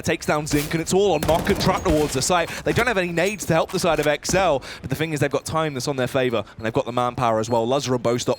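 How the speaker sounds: excited high play-by-play; esports caster. Real, not acted. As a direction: build the hype with every kill. Caster calls clutch round.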